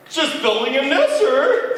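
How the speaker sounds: high, loud chirpy bird. Acted. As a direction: bird answering the moose cheekily. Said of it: "the sing-a-zoo said".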